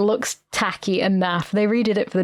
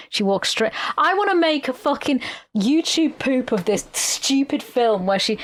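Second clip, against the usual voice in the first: ordinary delivery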